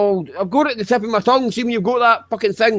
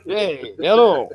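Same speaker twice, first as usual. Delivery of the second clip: mock northern voice; slower than usual. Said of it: "You sound like Alan Bloody Croasdale".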